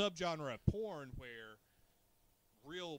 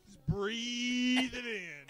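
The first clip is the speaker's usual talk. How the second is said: very long, loud, high stretched BREATHE; drawn-out mock urging; 8 semitones higher, 11 dB louder, much slower than usual. Urging the fart audience to breathe it in, drawn-out and loud. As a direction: stretch the vowel out, pitched up and loud.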